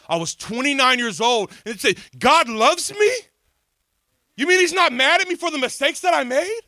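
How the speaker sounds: high, loud astonished cry; stunned and overjoyed; astonished disbelief. Retold or imitated. Retells his astonished reaction.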